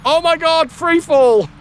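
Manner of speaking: high loud panicked shout; panicked yell. Retold or imitated. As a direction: yell it like you're falling. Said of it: "being like".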